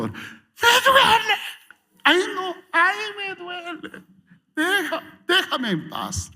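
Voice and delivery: high, hammed-up whining in pain; mock pained complaining, very high; whiny pained voice. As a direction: whine like a hurt child.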